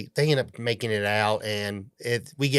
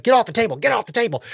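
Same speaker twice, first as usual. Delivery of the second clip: yelling at the cat; 11 semitones higher, faster than usual. Retold or imitated. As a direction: reenact shouting the cat off the table. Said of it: "So I'm yelling at her".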